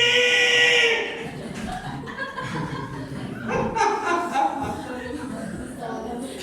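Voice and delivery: high laugh after the story. Real, not acted. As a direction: burst out laughing at your own tale.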